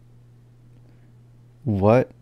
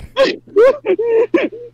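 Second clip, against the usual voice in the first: crying sob; 4 dB louder than usual. Real, not acted. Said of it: "now I'm gonna cry".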